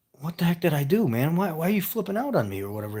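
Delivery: high-pitched, bewildered complaint; retold defensive protest. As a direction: lightly imitate an indignant, puzzled reply. Retold protest to upset wife.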